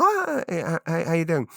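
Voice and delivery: high-pitched, stammered hello; hammed-up small talk; mock cheery greeting. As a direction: greet an acquaintance with put-on eagerness. Acted chance greeting of acquaintance.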